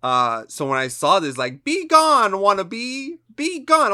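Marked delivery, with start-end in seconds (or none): 1.6-3.9 very high, loud hammed-up quote; mocking movie line. Mocking a movie line to his cohost, shrill and hammed-up. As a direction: squeal it like a cartoon villainess. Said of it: "Who the fuck talks like that".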